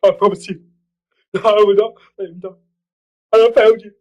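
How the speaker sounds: Alfred quote. Retold or imitated. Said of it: "It's like".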